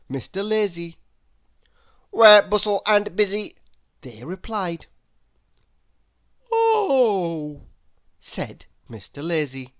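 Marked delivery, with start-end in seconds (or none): 2.1-3.6 loud and brisk; the busy men answering Lazy, loud and brisk. A narrator's reading. The busy men reply together. 6.5-7.7 a drawn-out drowsy oh; Mister Lazy answering drowsily; sleepy Lazy. A narrator's reading.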